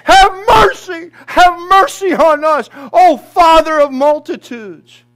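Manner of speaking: high, loud pleading cry; desperate crowd crying to God; screaming for mercy. Acted. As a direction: beg for mercy at the top of your voice. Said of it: "screaming out to the Father himself".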